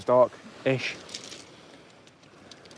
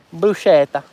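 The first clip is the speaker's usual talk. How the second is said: lightly hammed-up Italian food words, pitched up; mock Italian accent; 5 semitones higher, 4 dB louder, slower than usual. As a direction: put on a playful Italian lilt.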